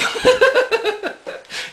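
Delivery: a real high burst of laughter. Real, not acted. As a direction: break into a high helpless laugh. Laughing at the story.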